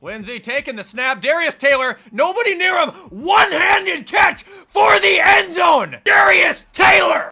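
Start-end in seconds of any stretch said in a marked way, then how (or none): 1.2-7.3 loud, high, stretched touchdown scream; ecstatic shout. Real, not acted. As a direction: scream it like the winning score. Calling a touchdown.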